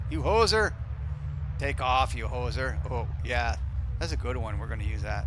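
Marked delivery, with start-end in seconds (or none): 0.1-2.8 mock Canadian heckle, loud at first then easing; Canadian accent. Pilot to other planes, mock Canadian accent heckle. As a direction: scold like a cartoon Canadian, in accent. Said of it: "I can use my Canadian accent".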